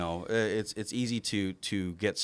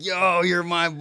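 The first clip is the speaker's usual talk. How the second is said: put-on loud, higher, thrilled shout to his boy; excited shout to son; 8 semitones higher, 7 dB louder than usual. Proud dad calling out to his grinning son, loud and thrilled. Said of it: "And I'm like".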